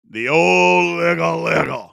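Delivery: loud, slow hyped-up call; hype shout. Acted. Host to live viewers, loud slow hype call. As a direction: shout it like a party announcer. Announcing number nine, hyped.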